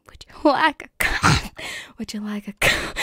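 loud laugh breaking the line; losing it mid-sentence; cracking up. Real, not acted. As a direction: try the line while bursting with laughter. Cracks up mid role-play.

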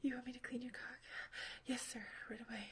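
hushed, close whisper held line after line; intimate whisper. Acted. Partner whispering to her lover during a roleplay, intimate whisper. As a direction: keep it all whispered and close to the mic.

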